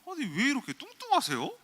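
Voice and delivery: high, loud incredulous quote of a blunt first-date jab; 대놓고 따지기. Retold or imitated. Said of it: "막 훑어보더니". (어이없다는 듯 높고 크게 따지는 흉내)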